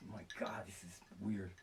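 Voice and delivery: unvoiced whisper to himself; a hushed aside. Real, not acted. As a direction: breathe it out privately. Muttering to himself.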